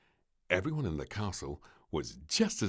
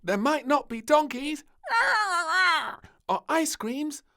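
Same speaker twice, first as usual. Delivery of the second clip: put-on upbeat child; 10 dB louder than usual. Child starting cheerful speech.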